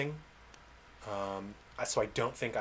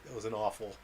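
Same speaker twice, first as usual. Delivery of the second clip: usual speaking voice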